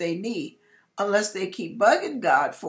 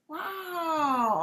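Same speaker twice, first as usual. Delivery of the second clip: awed wow; 7 semitones higher than usual. Retold or imitated. Awe at snow behind closed eyes.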